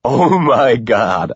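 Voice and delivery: slow laughing oh my God; a touch of laughing. A narrator's reading. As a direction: let it spill out through laughter. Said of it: "I laugh out".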